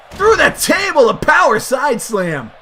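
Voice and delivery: high, loud ringside yell; real thrilled commentator shout; excited shout. Real, not acted. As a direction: cry out the big crash as it happens. Calling a table crash.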